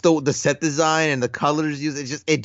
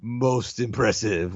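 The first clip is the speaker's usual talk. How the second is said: imitating Vader; 3 semitones lower, slower than usual. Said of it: "one of my favorite lines".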